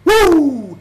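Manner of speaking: loud high wrestling woo; Ric Flair woo. Acted. Wrestling promo hype.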